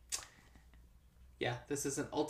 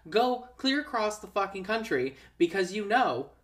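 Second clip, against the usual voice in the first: higher, louder urgent pleading; exasperated pleading; 6 semitones higher, 7 dB louder than usual. Pretend. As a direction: plead with her to run. Pleading with movie character.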